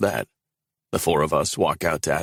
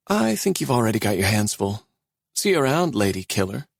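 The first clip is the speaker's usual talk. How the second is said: put-on lightly higher young woman, teasing send-off; Sienna teasing; 6 semitones higher than usual. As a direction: she brushes him off on her way out, mild teasing young woman.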